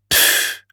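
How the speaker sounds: voiceless huff of annoyance; huffy pff. A narrator's reading. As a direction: blow out a sulky pff. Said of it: "deed Julius".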